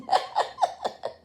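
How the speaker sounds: sudden high-pitched laugh; high cackle of laughter. Real, not acted. Vlogger laughs at her own joke with a high cackle. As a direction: let out a squealing laugh.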